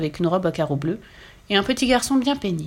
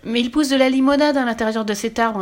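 put-on raised, insistent child's protest; 4 semitones higher, 4 dB louder than usual. A narrator's reading. Boy argues for saving the tree, insistent. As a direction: press the point in a higher voice. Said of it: "insista-t-il".

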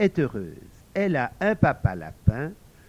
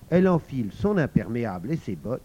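plain reading voice

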